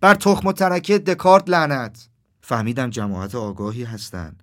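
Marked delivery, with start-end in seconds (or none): 0.0-2.1 high, very loud, drawn-out curse; slow, high-pitched angry shout. A narrator's reading. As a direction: yell the curse to the crowd. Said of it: "داد زد و گفت".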